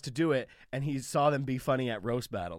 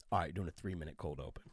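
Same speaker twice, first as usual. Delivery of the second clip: much lower, softer flat announcement; deadpan sound guy; 11 semitones lower, 11 dB quieter than usual. Retold or imitated. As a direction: drop low and soft to deliver the news. Sound guy quoted low.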